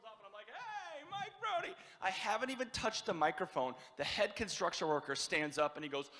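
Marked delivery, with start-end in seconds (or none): 0.5-1.7 high, soft mock intro; feeble introduction. Bartender introducing the comic before leaving, high and feeble. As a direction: imitate a half-hearted announcer, small and high.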